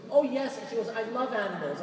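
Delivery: a lightly voiced Long Island woman gushing; the woman in fur tells the friar, in a light Long Island accent. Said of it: "That's a Long Island accent".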